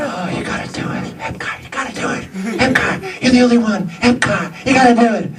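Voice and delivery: high, pleading mock nagging; pleading producers. Producers pestering him to take the part, high mock pleading. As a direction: beg him over and over in a squeaky voice. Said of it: "they kept coming back to me and saying".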